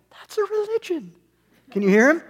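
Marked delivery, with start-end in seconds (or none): 0.1-1.1 very high mock inner-thought voice; a squeaky, knowing aside; high-pitched retort as the audience's thought; Gaffigan inner voice. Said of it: "goes into a high-pitched voice to answer it".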